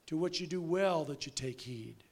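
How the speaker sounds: normal talking voice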